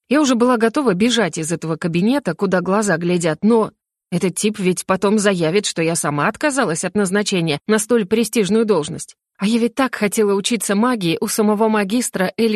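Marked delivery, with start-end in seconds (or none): none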